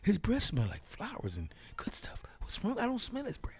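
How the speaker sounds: high-voiced friend. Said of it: "He said".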